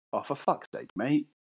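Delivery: mild, understated dad grumble; a hint of an exasperated father; weary dad. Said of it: "my dad was like".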